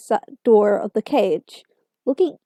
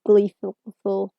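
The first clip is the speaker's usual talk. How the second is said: plain reading voice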